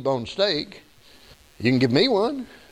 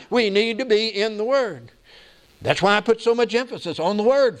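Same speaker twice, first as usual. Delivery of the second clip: higher-pitched, insistent exhortation; preaching; 7 semitones higher than usual. Real, not acted. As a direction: urge the congregation like a pastor. Exhorts the congregation.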